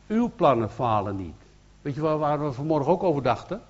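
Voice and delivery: ordinary delivery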